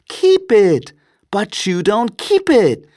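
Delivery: very high, lively retort; high-pitched young nephew protesting; incredulous nephew. A narrator's reading. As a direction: exclaim it high in disbelief.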